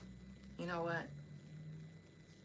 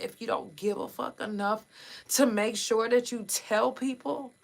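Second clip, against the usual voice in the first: ordinary talk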